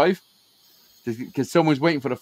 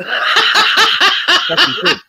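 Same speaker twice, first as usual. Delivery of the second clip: laughing; 14 semitones higher, 8 dB louder, much slower than usual. Real, not acted. Insisting through laughter.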